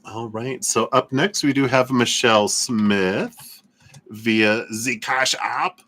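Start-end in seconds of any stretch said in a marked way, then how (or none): 4.1-5.8 playful, slow mock-German accent. Host announcing a payment app, playful German accent. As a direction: slip into a drawn-out German accent for fun. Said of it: "I always go into a German mode".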